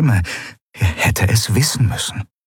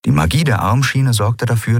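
plain reading voice

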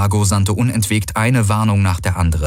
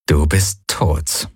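cold, slow finality; 4 semitones lower, much slower than usual. Acted. Finishing line after the kill.

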